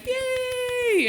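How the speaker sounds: a high, bright cheer; mock crowd cheer, pitched high. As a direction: whoop like a delighted audience. Imitating a cheering audience.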